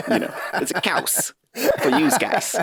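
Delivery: a hammed-up New Jersey wiseguy; bad New Jersey accent. Host jokes about cheese in a New Jersey accent. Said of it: "with a New Jersey accent".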